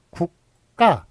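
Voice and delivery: 국가 sounded out slowly as "국까"; 국가 in two drawn-out syllables, second one tensed; tensed pronunciation. Shown as a demonstration. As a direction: say 국가 slowly with a tense kk, "guk-kka". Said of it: "요런 식으로 발음되죠".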